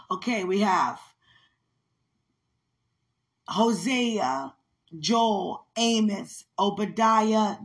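loud, deliberate recital of the prophets; proud Bible-class recital; recitation. Acted. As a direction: call each book out clearly, like a drill. Recites all the prophets a last time.

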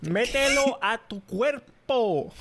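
slow and emphatic. Pretend. Jokey slow emphatic command.

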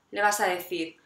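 normal talking voice

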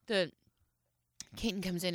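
normal talking voice

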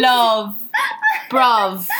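London slang "Love", "Bruv" in mock accent. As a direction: channel a London lad. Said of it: "English accents".

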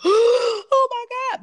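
high, loud, slow gasping "Oh my God"; overwhelmed gasp. Retold or imitated. Retelling her shock at her first big sale, high and tearful. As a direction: near tears with disbelief. Overwhelmed by client buying program.

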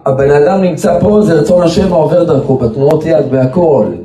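sermon at full force about the holy man; fervent preaching. Acted. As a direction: preach it with rising fervor.

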